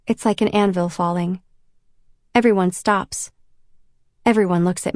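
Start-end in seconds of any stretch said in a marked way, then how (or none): none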